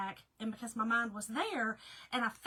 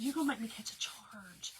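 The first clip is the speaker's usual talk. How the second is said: whisper; 4 dB quieter than usual. Retold or imitated. Hushed plea to loud officer.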